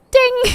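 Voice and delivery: high, ringing mock bell; imitated ding. Streamer closes the grass-touching bit, imitated bell ding. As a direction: sing out a high little bell sound.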